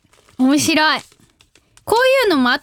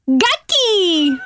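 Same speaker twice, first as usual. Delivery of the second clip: magic-word cheer; 4 semitones higher, slower than usual. Shown as a demonstration. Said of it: "magic word performed on request".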